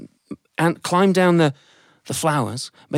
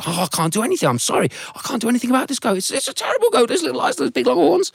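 very high, fast, despairing voice; an old soldier admitting defeat; squeaky wail about the terrible goat; 12 semitones higher, faster than usual. Acted.

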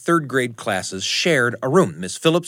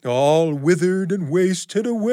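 spooky ghost voice; 6 semitones higher than usual. Acted. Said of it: "in that same spooky voice".

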